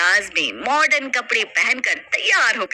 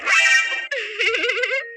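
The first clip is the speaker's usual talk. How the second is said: shrill possessed laugh, much higher; eerie high cackle; 11 semitones higher than usual. Acted. Possessed bahu laughing at her mother-in-law, eerie cackle. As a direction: cackle like a spirit has taken her.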